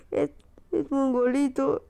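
laughing through the words; giggling. Real, not acted. Gamer laughing at the odd baby character. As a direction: laugh as you call him silly.

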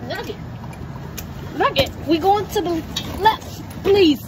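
high strained plea to a pulling dog; high pleading to a pulling dog. Real, not acted.

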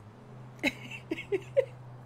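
thin high-pitched wheeze of a laugh; breathless high laugh after choking. Real, not acted. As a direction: laugh through a choking cough. Choking fit mid-sentence.

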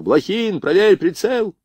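high-pitched called order; military command. A narrator's reading. Officer orders Blokhin to check the sight, commanding call. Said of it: "скомандовал Родионов".

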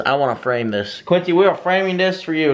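ordinary talk, nothing marked

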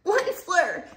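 the Lorax croaks at the Onceler through smog; croaking cry. A narrator's reading. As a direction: croak out the name. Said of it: "he cried with a crofulous croak".

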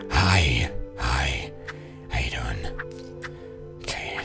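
very soft, low, whispery hello; hushed greeting. Pretend. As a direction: murmur a furtive hello under your breath. Awkward greeting to game characters.

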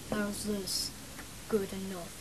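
low, quiet put-on boy voice; acting like a boy. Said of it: "I'll act like a boy".

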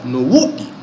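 usual speaking voice

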